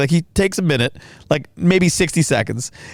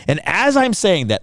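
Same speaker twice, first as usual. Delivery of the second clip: normal talking voice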